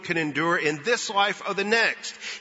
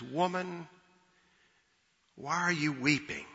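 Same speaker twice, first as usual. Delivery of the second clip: soft, gentle, compassionate asking; hushed compassion; compassionate question; 8 dB quieter than usual. Retold or imitated. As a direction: ask tenderly, much more quietly, with room after the first word. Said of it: "asked this question out of compassion".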